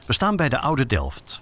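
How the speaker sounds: normal talking voice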